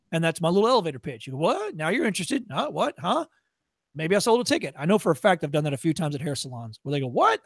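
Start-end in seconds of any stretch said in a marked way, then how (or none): 1.4-3.3 high, rising mock-startled questions; suddenly interested. Acting out suddenly intrigued listener. 7.1-7.4 high, rising mock-startled question; sharply rising put-on surprise; surprised reaction. Imitating surprised salon customers.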